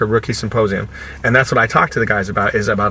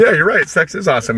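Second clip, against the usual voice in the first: enthusiastic agreement; 6 semitones higher than usual. Retold or imitated. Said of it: "I was like".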